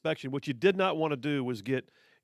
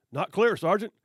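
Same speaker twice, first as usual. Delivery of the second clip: audacious reply; 5 semitones higher, 7 dB louder than usual. Retold or imitated. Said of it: "I had the audacity to say".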